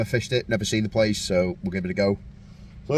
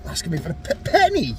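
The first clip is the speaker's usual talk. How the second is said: incredulous; faster than usual. Real, not acted. Mate asks to borrow a penny.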